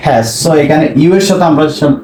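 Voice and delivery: ordinary talk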